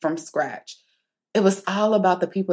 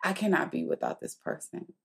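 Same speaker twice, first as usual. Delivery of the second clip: hushed, inward voice; saying to herself; 8 dB quieter than usual. Retold or imitated. Woman admitting to herself she needs this person, hushed. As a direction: say it softly, as a private realisation. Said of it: "saying to myself".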